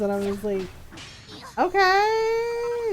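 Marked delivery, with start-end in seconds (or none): 1.6-2.9 high, long-drawn okay; humoring reply. Retold or imitated. Answering Jules's request to join, humoring him. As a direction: stretch it out high, as if unsure about this.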